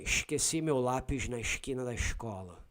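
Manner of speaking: Rio accent, S turned to "sh" in esqueci, lápis, escola; Carioca accent. Shown as a demonstration. Showing a listener how Cariocas say it, Rio accent. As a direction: shush every S like a Carioca. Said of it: "Cariocas will say".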